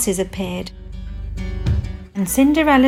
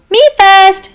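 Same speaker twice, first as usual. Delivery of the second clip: loud, high, bossy demand; 10 semitones higher, 11 dB louder than usual. Acted. Ugly stepsister grabbing the first try, demanding. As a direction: push in loudly and high. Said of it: "demanded the first ugly stepsister".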